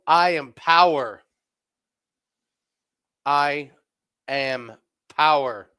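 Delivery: loud, slow, mock-grand; slow booming mock proclamation; loud slow declaration. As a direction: announce it like a king. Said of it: "reply to boss".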